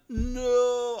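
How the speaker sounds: high, stretched mock wail; hammed-up long cry of despair; Darth Vader. Host retelling the villain's breakdown, high drawn-out mock cry. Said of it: "the worst no in, in cinema history".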